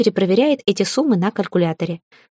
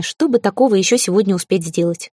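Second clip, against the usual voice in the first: ordinary delivery, nothing marked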